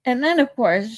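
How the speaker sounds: normal talking voice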